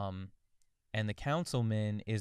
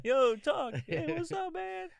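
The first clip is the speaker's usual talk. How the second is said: very high, loud comic greeting; goofy high-pitched cartoon turtle; talking animal; 5 dB louder, slower than usual. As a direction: greet a pal in a silly squeaky voice. Acting turtles revealing they talk.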